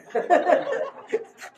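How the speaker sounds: high, delighted laugh. Real, not acted. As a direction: laugh out with pure joy. Laughs at his own news.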